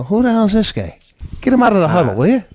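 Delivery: mock annoyed player. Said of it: "the team would look at me and go".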